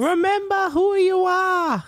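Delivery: high, slow, loud, solemn delivery; grand mock pep talk. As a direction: intone it like a movie mentor. Mock dramatic inspiring line.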